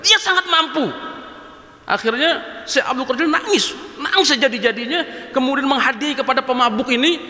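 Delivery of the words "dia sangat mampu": higher, firm repeat; the sheikh repeats his answer firmly to the drunkard; sheikh's reply. Acted. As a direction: restate it calmly and firmly. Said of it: "kata Syekh Abdul Qadir Jailani".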